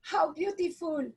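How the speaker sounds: high-pitched retold delight; delighted surprise. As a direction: retell a moment of delighted recognition, pitched high. Retells her delighted reaction to pendant.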